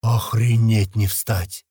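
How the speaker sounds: slow, drawn-out amazement; stunned amazement. A narrator's reading. Man floored by talking monkeys, slow stunned exclamation. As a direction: stretch the words out, dumbfounded. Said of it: "полностью обалдевший произносит Туман".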